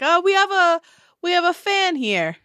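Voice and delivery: amused dwarf. Acted. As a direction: announce a fan with delight. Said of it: "the man on the ground's like".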